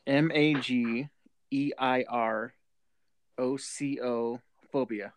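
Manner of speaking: slow letter-by-letter spelling. Shown as a demonstration. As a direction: spell it out one letter at a time. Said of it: "Can you spell it one more time?".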